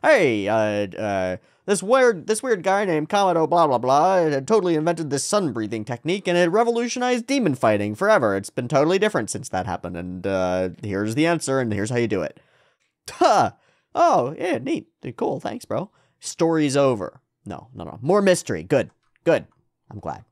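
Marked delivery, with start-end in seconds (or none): 0.0-12.4 lightly voiced cheerful explainer; breezy mock info-dump; voicing a know-it-all book. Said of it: "It's like". 13.1-17.2 sarcastic. Pretend. Sarcastic reaction to easy answer.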